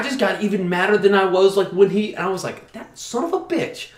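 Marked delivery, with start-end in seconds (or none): 2.7-3.9 high, slow, seething curse under the breath; muttered curse. Retold or imitated. Fuming at the gym guy who took the bench, seething. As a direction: spit it out, fuming but held in. Fuming after being bullied.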